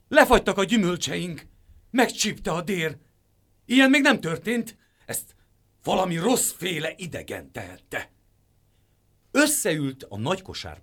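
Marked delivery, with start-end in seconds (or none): 0.1-8.1 high, loud alarmed crying out; outraged uproar. A narrator's reading. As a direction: panic over the frozen fruit. Villagers in uproar over frost.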